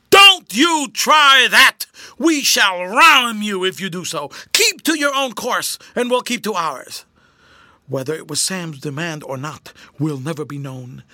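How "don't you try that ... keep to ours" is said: shouted warning, easing towards the end; loud megaphone call. A narrator's reading. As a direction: bellow a threat across to a rival boat. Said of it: "seized the megaphone and called in his loudest tones".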